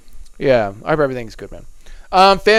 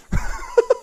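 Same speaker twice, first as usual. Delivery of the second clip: breathy laugh; 6 dB quieter than usual. Real, not acted. Laughs after apologising to chat.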